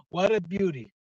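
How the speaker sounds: ordinary talk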